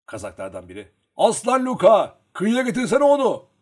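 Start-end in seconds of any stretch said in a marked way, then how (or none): 1.2-3.5 loud, high-pitched call; shouting. A narrator's reading. A Cossack urging Luka to bring it ashore, shouting. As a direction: yell to someone down at the river. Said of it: "diye bağırdı".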